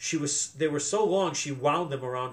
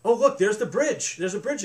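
exclaiming; 6 semitones higher, 4 dB louder than usual. A narrator's reading. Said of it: "exclaimed Ellen".

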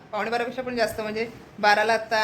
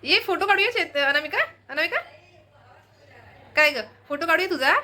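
cooing to bird; 7 semitones higher than usual. Real, not acted. Calling bird for photo.

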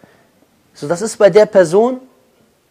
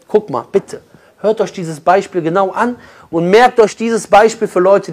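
usual speaking voice

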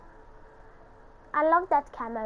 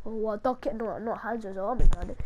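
movie character imitation; 6 semitones lower than usual. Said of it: "this guy from this animated movie? He's like".

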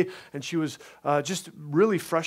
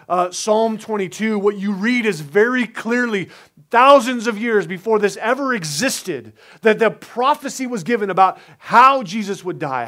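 full-force preaching; 6 semitones higher, 7 dB louder than usual. Acted. Pastor preaches the crucifixion to his church, louder and higher. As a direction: drive the point home to the congregation.

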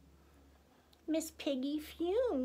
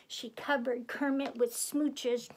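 usual speaking voice

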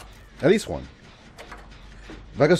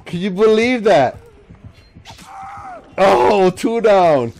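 higher, louder thrilled talk; excited; 7 semitones higher, 6 dB louder, slower than usual. Real, not acted. Gamer telling viewers about two down, excited and loud. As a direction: share the win with the viewers. Celebrating game progress.

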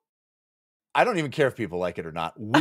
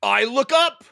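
high, louder shout; 4 dB louder than usual. Acted. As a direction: shout it out in alarm. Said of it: "shouted Righteous Pucker".

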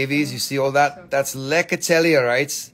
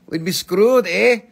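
higher, louder Canadian eh; mock Canadian; 7 semitones higher, 7 dB louder than usual. Seller teasing Canadian buyers, mock Canadian accent. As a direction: tease it with a Canadian eh.

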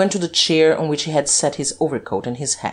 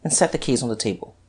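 ordinary delivery

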